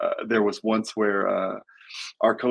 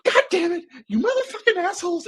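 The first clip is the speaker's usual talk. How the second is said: very high, exploding coach rant with a laugh; coach snaps and yells. Retold or imitated. Apologizing coach loses it and curses the team, explosive yell. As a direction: blow up like an old coach who can't hold it in. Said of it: "He goes".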